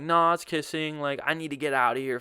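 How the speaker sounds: acted-out insistence; retold argument. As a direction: push back fast and loud. Re-enacts arguing back.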